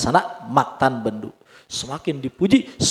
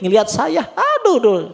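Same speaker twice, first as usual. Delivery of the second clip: jauh lebih tinggi dan keras, mengaduh; keluhan lebay bercanda; mengeluh dibuat-buat; 10 semitones higher, 5 dB louder than usual. Acted. Acting a man lamenting his lot. (orang mengeluh aduh-aduh dengan lebay)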